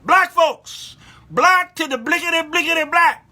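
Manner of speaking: high, loud rapid-fire chant; calling out. Real, not acted. Mock-scolding call out to audience.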